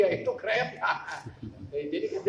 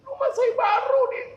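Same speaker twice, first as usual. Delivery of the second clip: pleading plea; 5 dB louder than usual. Retold or imitated. Retold plea of threatened owner.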